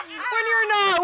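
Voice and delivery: high laughing squeal. Real, not acted. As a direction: laugh through the words. Laughing at own joke.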